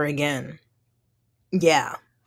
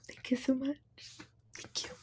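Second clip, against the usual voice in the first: whispered thanks; 6 semitones higher, 13 dB quieter than usual. Real, not acted.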